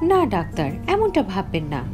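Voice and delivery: ordinary delivery, nothing marked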